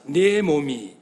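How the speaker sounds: slow, halting phrases with long pauses; slow call for the congregation to repeat. Shown as a demonstration. Preacher leading the congregation in repeating God's words, slow and halting. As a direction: dictate each phrase slowly so the church can echo it. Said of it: "그 다음에 따라합시다".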